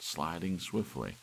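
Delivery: ordinary delivery, nothing marked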